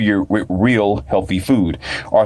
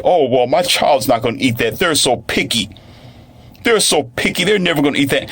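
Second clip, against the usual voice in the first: higher-pitched imitation of a fussing parent; mock complaining parent; 6 semitones higher than usual. Parents excusing picky children, mock complaining. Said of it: "always complain and say".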